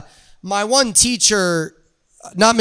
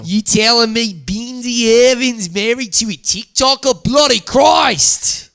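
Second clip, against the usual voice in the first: hammed-up Aussie disbelief, rising to a drawn-out shout; Australian accent; 3 semitones higher than usual. [name] reacts to Bindi's TikToker husband in a hammed-up Australian accent. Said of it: "You're good at accents".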